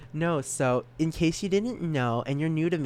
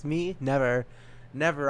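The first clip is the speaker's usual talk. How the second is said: usual speaking voice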